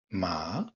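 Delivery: MA rising; second tone. Shown as a demonstration. Teacher shows learners the second Chinese tone, rising. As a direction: say "Ma" with the pitch climbing to its end.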